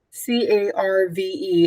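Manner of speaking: Carve said, then spelled letter by letter. Shown as a demonstration. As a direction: spell C A R V E slowly for the class. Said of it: "spelling the word".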